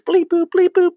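high mocking bleep boop noises; mock computer beeps. Ruffian mocking Wesley's computer with high beeps. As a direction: squeak out fake computer beeps. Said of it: "You with your little computer".

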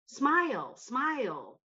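patronizing imitation, each word swooping down; imitated condescension. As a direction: mimic men telling a woman to smile. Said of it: "It's condescending".

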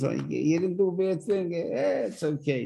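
high, breezy brush-off, like it's nothing; dismissive forgiver. Acted. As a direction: wave it away: you didn't do a bad thing.

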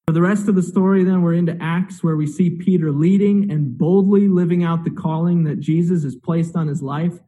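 ordinary talk

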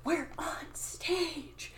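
slow, hushed, drawn-out whisper; urgent stage whisper. Retold or imitated. Scene partner reminding Sarah mid-scene, stage whisper. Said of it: "then he improvs".

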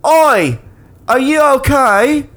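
put-on high Australian old lady voice; a kindly Australian lady offering help. To a sick stranger on the road, high Australian old lady. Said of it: "this old lady pulls up and goes".

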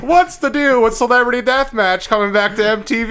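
sing-song observational comic delivery; Jerry Seinfeld impression. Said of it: "What, Jerry Seinfeld?".

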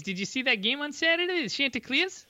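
high, hammy Boston sports fan; Boston accent. Pretend. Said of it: "Chanticleers in a Boston accent".

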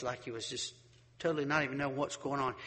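usual speaking voice

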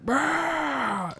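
high rolling war cry; raging battle roar. Acted. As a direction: roll out a berserk battle trill. Raging barbarian attack cry.

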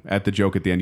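normal talking voice

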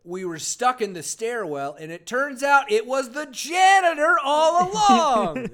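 loud, high, slow twist reveal; mock dramatic reveal. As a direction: sell the dumb twist like a cheesy mystery. Parodying a silly story ending.

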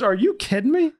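high-pitched imitation of disbelief; mock incredulous. Boat owner told to wash down, mock incredulous.

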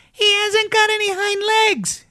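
very high rabbit cry; high-pitched taunting announcement; calling out. A narrator's reading. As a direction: call it out mockingly in a squeaky high voice. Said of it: "he called out".

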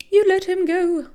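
high, loud demanding scream. A narrator's reading. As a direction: scream at the man to release a brother. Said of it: "the woman screamed".

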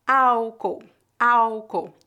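Álcool with the final L said as U, awkow; slow, clear Álcool, L becomes U; pronunciation demo. Shown as a demonstration. Teacher modelling Álcool for learners, slow and clear. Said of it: "Nós não pronunciamos o L foneticamente".

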